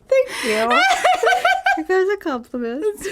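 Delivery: high, squealing laughter; laughing. Real, not acted. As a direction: thank him while cracking up. Laughing after being called weird.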